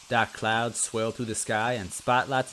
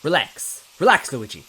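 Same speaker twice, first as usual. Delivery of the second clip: louder, firm command to himself; firm self pep-talk; 4 semitones higher, 8 dB louder than usual. Acted. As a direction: snap yourself out of it. Luigi calming himself, after slap.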